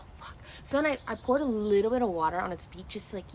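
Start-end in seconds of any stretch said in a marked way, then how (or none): 0.0-0.4 retold, barely voiced whisper; whispered dismay. Streamer retells seeing the other bird not waking, whispered dismay. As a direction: drop to a whisper as the hope goes.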